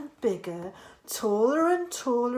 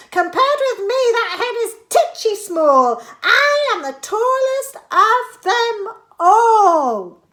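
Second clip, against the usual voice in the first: high, loud sing-song rhyme; high loud old woman, chanting; boastful Grandma; 11 semitones higher, 10 dB louder than usual. A narrator's reading. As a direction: crow over the hen as Grandma.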